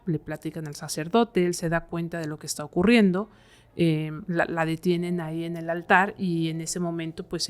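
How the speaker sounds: normal talking voice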